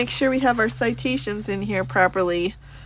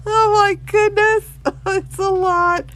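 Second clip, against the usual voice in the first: laughing; 8 semitones higher, 4 dB louder than usual. Real, not acted. Cracks up at the book.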